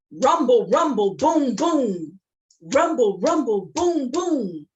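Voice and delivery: loud, punchy rumbling chant; elephant rumble chant. Acted. Jabari and the elephant walking, loud rumble. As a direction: stomp it out like an elephant. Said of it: "they said".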